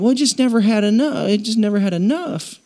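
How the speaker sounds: a hint of Southern twang making excuses; Southern accent. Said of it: "I don't know why I'm Southern".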